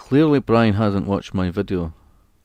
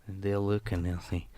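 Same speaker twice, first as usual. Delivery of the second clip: slow, hushed, measured delivery; softly and slowly, drawing out each phrase; eerie film monologue; 7 dB quieter than usual. Acted. Streamer reciting what they'll say of them, slow and soft.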